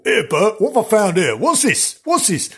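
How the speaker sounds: high, rough-and-ready workman voice; comic high-pitched labourer; puzzled workman. Acted. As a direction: call your mate over in puzzlement. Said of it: "can you imagine what that was like?".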